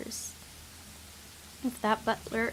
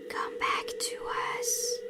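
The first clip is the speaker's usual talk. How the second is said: slow, unvoiced eerie whisper from nowhere; ghostly whisper. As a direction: breathe the words like a spirit luring him home. Haunting voice in his head.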